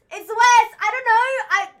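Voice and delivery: ordinary talk, nothing marked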